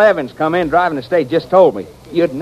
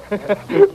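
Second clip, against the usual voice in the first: high chuckle; 10 semitones higher than usual. Acted. Charlie laughing at the news.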